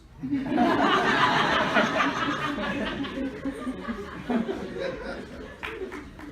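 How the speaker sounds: laughing. Real, not acted. Laughing at own answer.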